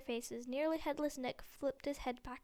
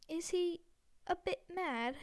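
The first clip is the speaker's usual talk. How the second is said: uncertain; 5 semitones higher than usual. A narrator's reading. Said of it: "he asked Percy uncertainly".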